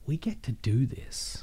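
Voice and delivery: soft, slow and awed; awed hush. Retold or imitated. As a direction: quietly marvel at it. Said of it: "I was just like".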